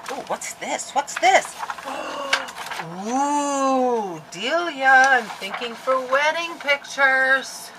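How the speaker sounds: high, delighted cooing; excited squeal. Real, not acted. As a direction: gush over something pretty. Delighted discovery of paper.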